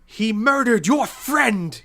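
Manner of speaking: high, loud, slow accusing shout; furious trickster god; outraged accusation. Acted. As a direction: shout it in outrage, drawing out each word.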